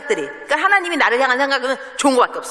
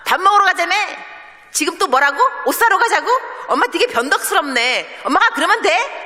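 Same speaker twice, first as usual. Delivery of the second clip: higher and louder, mocking complaint; sassy daughter; 8 semitones higher, 3 dB louder than usual. A daughter scolds her mother for changing plans, sassy. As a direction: needle mom for being fickle.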